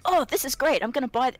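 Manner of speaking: bright and loud, pitch jumps up; mock excitement. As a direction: gush like you just found the perfect buy. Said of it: "I'm like".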